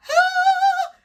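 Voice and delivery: high, loud swooning sigh; a long lovestruck sigh; dreamy sigh. Real, not acted. Swooning over cat and food novels, a dreamy sigh.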